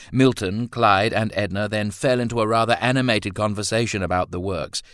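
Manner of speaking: ordinary delivery, nothing marked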